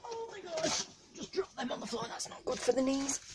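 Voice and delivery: high, soft dismayed squeak; a startled little cry under the breath. Real, not acted. Dropping things on the floor, squeaking in dismay.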